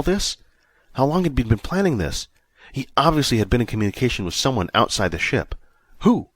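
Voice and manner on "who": plain reading voice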